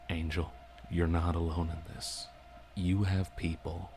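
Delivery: ordinary delivery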